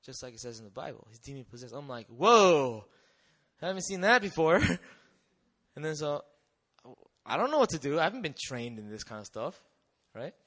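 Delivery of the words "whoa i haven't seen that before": loud startled whoa, fast; shocked whoa. Retold or imitated. Teacher sees a possessed boy, loud startled exclamation. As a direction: blurt it out in shock.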